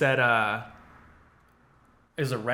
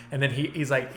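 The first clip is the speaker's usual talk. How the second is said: normal talking voice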